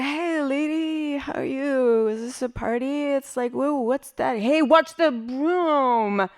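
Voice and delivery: a stoned Hollywood lizard being swept. Pretend.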